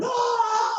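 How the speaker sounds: high mock crowd scream; imitated angry scream. Imitating fans raging at the referee's flag, mock screaming. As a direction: mimic a furious fan's high-pitched yell. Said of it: "they're screaming".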